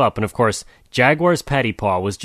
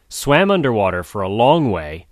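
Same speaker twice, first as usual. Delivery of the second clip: ordinary delivery, nothing marked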